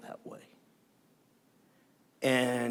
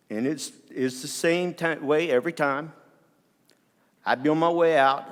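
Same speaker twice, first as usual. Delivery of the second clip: normal talking voice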